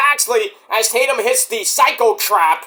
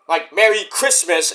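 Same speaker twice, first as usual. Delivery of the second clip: ordinary delivery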